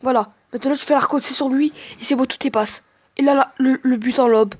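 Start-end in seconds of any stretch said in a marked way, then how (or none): none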